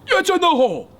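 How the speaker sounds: high loud buddy voice; friends' announcement. Retold or imitated. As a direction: call it out like the friend at the hole. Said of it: "they're like".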